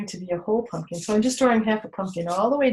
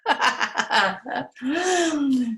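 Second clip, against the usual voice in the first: playful giggle; 4 semitones higher than usual. Pretend. Giggling pumpkin sound.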